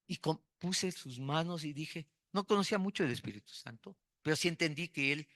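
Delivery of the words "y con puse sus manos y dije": usual speaking voice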